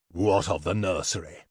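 low, menacing dragon king; deep royal demand; dragon king, angered. A narrator's reading. Dragon king's rage sparks at news.

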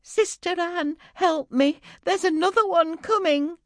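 high, loud, panicking plea; a young girl's terrified high call; panicked cry. Acted. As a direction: cry out in fear for help. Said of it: "Panicking, she cried out".

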